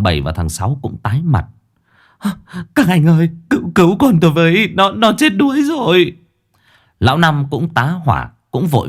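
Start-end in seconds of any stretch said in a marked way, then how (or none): 2.7-6.2 high, stammering scream; frantic mother wailing for help; panicked plea for help. Acted. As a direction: beg for rescue in desperation. Mother begs rescue of drowning son.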